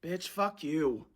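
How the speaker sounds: quiet slow muttered curse; muttered fuck you. Real, not acted. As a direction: curse under breath. Filter mocks her.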